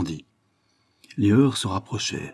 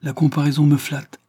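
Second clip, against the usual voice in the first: higher, amused irony; lightly amused, lifted voice; 7 semitones higher, 4 dB louder than usual. A narrator's reading. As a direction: play flattered with an audible smile. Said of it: "Delbon sourit amusé".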